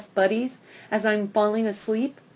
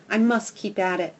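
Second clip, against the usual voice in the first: ordinary delivery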